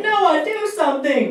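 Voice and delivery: hammed-up terrified shriek; panicked scream. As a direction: scream it like a terrified friend facing a knife. Friend screams for help.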